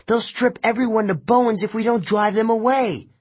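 high, urgent warning; urgent yell. A narrator's reading. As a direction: call it across to the next chariot. Annabeth yelling warning beside chariot.

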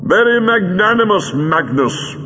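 high call across the room. A narrator's reading. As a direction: call back to the enemy, pitch dropping on the name. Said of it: "called the Doctor".

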